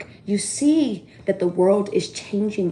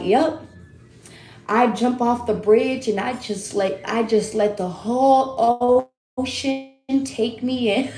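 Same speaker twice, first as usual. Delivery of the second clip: slow, drifting, serene surrender voice; dreamy calm guy; 3 semitones higher than usual. Retold or imitated. Retelling the bridge survivor's words, lightly voiced, slow and dreamy. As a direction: float the words out like letting go. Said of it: "The way he said it".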